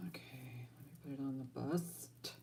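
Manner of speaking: unvoiced whisper. Real, not acted. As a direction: whisper it to yourself. Quiet aside while setting up.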